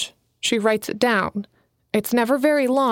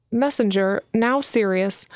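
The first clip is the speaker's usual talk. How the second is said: plain reading voice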